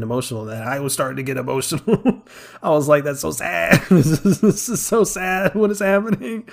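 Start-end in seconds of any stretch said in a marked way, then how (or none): 3.0-6.5 higher, louder, half-laughing dismay; laughing mock despair over a sad scene; mock distress. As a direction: play up being overwhelmed. Own reaction while tearing up.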